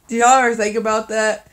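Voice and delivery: ordinary talk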